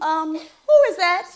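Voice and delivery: pointed questioning. Acted. Woman confronts boyfriend on hearing a female voice, pointed question. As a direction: demand an answer, drawing the words out. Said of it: "So I say to him".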